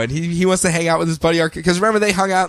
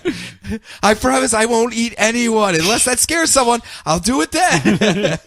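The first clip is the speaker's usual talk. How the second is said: much higher, mock pleading with laughter; desperate Scarecrow; 10 semitones higher than usual. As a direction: beg on your knees, hammed up. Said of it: "screaming and crying".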